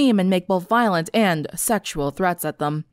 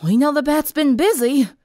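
higher, disgruntled remark; 6 semitones higher than usual. A narrator's reading. Applejack summing up the bad night, disgruntled. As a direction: grumble it with pitch lifted. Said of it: "Applejack said disgruntled".

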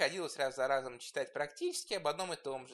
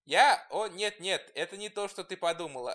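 flustered excuse; 2 semitones higher, 9 dB louder than usual. A narrator's reading. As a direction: caught red-handed, fumbling denial. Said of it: "оправдался Сивил". (смущённое оправдание)

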